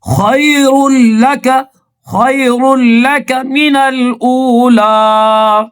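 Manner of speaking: melodic measured tilawat; Quran recitation. Acted. Teacher recites the surah verses for students to follow. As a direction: chant each verse, stretching the long vowels.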